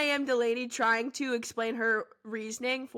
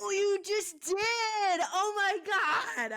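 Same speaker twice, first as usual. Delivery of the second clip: real mortified protest, pitched up; mortified outcry; 7 semitones higher than usual. Real, not acted. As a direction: groan it high, caught out and embarrassed. Embarrassed at being last name dropped.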